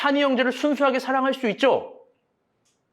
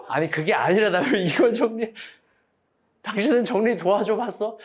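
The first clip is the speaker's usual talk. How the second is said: ordinary talk, nothing marked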